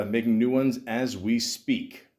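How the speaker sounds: ordinary talk, nothing marked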